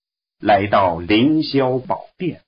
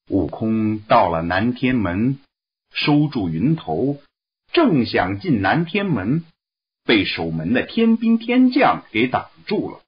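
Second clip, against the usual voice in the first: ordinary delivery, nothing marked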